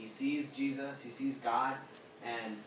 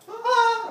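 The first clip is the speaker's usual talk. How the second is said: very high, booming "ah"; sudden acted wail at top pitch; loud high cry; 14 dB louder than usual. Preacher imitating voices that shake the walls, loud high cry. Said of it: "saying it so loud".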